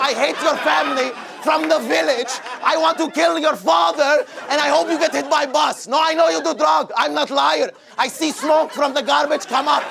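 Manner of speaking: angry wife, retold. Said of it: "My wife say".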